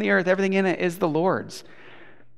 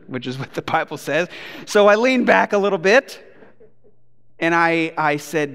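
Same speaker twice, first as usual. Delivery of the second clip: ordinary talk, nothing marked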